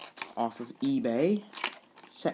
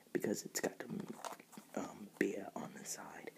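whisper; 4 semitones lower, 18 dB quieter than usual. Real, not acted. Aside about beer on box.

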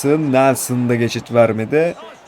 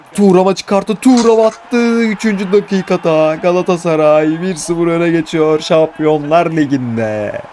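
goal call; 6 semitones higher, 4 dB louder than usual. Real, not acted. Goal call in live commentary.

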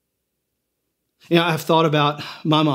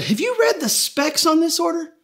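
raised, earnest voice of God explaining a design; God proudly listing the specs aloud; a hint of a proud, eager creator; God's voice; 8 semitones higher than usual. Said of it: "And God said".

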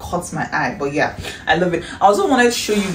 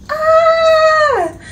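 high loud delighted shriek; excited shriek; 7 dB louder than usual. Real, not acted. Vlogger shrieking at the perfume box. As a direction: scream with joy.

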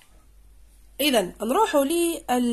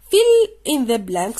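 very slow and louder, each word apart; slow instruction read; 6 dB louder, much slower than usual. Shown as a demonstration. Teacher reading the exercise instruction, very slow and louder. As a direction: spell out the instruction slowly. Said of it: "reading instruction".

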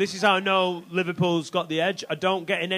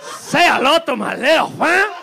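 put-on high, loud, unconvincing Albanian accent; bad Albanian accent; 5 semitones higher, 6 dB louder than usual. Comic repeating his gangster line, loud dubious Albanian accent. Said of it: "Doesn't sound Albanian".

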